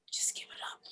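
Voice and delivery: a soft whisper to himself; whispered inner voice. Retold or imitated. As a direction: whisper it like a tempting thought. Inner voice urging him quietly.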